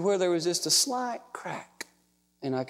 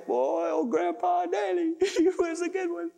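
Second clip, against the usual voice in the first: pitched-up affectionate reminiscence; admiring child's tribute; 10 semitones higher than usual. Pretend. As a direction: say it as a fond grown child remembering grandpa. Imagines his child's fond remark.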